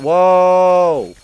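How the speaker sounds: long, high, loud whoa. Real, not acted. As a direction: shout in surprise. Sudden crash in game.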